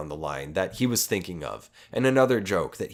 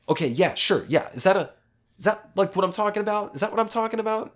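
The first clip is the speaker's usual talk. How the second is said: high, eager pestering questions; imitating the guy; 12 semitones higher, 3 dB louder, faster than usual. Imitating the pushy customer questioning the server, high eager voice. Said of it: "And the guy's like".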